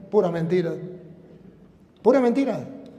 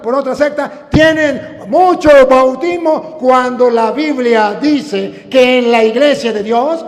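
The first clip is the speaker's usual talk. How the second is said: raised, driving sermon with rhythmic repeats; fervent preaching; 6 semitones higher, 9 dB louder than usual. Acted. Preacher arguing for one baptism, fervent preaching. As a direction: challenge them, then pound out the refrain.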